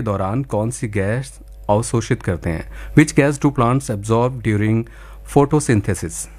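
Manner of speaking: slow, carefully spelled-out question; slow clear repeat. Shown as a demonstration. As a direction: repeat it slowly for a bad line. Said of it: "एक बार फिर से मैं सवाल दोहराता हूँ".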